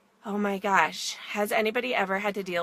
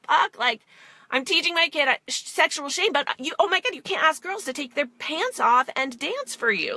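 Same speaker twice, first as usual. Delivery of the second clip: worked-up inner voice; 7 semitones higher than usual. Retold or imitated. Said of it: "I'm getting very emotional about this".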